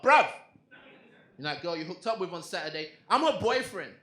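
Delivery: loud, rising outburst at a rival; angry confrontation. Acted. Boyfriend phones the man his girlfriend cheated with, angry shout. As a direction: confront him, building to a shout.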